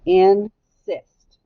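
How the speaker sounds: "Insist" in two separate syllables, in, then sist; slow syllable-by-syllable "Insist" for young pupils; split by syllable. Shown as a demonstration. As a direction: say "Insist" with a gap after a loud in. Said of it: "to scoop the word in".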